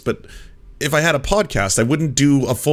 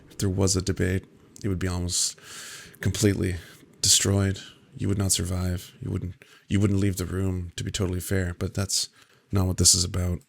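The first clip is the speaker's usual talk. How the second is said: low, soft, measured mock calm; Sam Harris voice; 6 semitones lower, 8 dB quieter than usual. Streamer mocks Sam Harris dismissing a debate, low and hushed. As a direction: hush down low and sound patiently superior. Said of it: "that's the Sam Harris equivalent".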